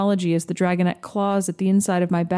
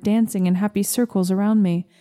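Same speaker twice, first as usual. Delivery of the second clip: ordinary delivery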